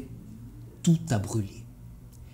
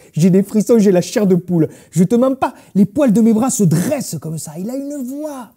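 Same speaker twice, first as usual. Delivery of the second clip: loud, high, worked-up raving; carried away with enthusiasm; gushing admiration; 6 semitones higher, 8 dB louder than usual. Acted. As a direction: rave about it at full volume. Gushing about the singer's voice.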